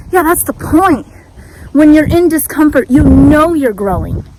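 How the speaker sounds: pumped-up motivational shout; loud pep talk. Real, not acted. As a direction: preach it to yourself with force.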